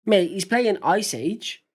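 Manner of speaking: ordinary delivery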